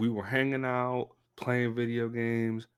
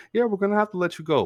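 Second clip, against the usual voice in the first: breezy firing; 11 semitones higher, 5 dB louder, much faster than usual. Retold or imitated. Manager fires him cheerfully.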